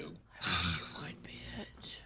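whispered insult under breath. Real, not acted. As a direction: hiss it quietly under your breath. Muttered insult at recording.

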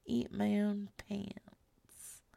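slow joking whisper. Pretend. Telling chat she'll eat her pants, slow whisper. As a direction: breathe it out like a silly secret. Joking breakdown after the show.

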